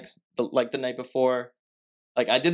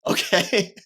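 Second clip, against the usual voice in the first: high, louder burst of excitement; excited reply; 10 semitones higher, 5 dB louder than usual. Retold or imitated. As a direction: answer the job offer with a thrilled, high-pitched okay. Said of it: "hear how excited you are".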